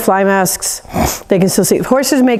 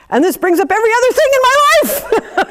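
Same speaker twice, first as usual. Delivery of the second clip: high, frantic overreaction; mock panic about life; flustered outburst; 4 dB louder than usual. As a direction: blurt it like everything is spilling over.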